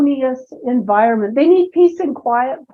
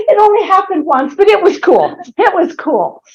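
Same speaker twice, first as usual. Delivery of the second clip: delighted; 6 semitones higher, 5 dB louder than usual. Real, not acted. Delighted punchline.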